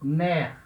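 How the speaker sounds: mère said with an open è, short and dropped; mère, one open syllable, è wide open; French mère. Shown as a demonstration. Teacher models mère for the grave accent. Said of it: "E com acento grave".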